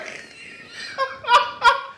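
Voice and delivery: loud, high burst of laughter; big laugh. Real, not acted. Teller cracking up at his own story, loud laugh. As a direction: explode into laughter.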